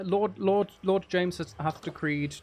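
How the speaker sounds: playful slow proclamation, drawn out; mock-lordly decree. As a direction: proclaim it like a pretend lord.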